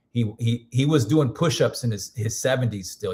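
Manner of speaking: ordinary talk, nothing marked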